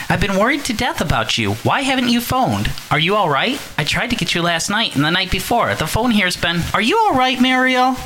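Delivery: high, fretful mother on the phone; worried mother. Acted. Mother phoning her daughter, high and anxious. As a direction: fuss anxiously over your daughter.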